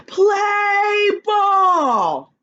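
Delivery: loud high shout; exclamation shout. Shown as a demonstration. Teacher reading an exclamation point, shouting it loud and high. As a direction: raise your voice for the exclamation point. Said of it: "I have to raise my voice".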